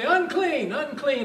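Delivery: high-pitched warning call, acted; crying out a warning. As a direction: call it out like a leper warning others. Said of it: "they're required to say".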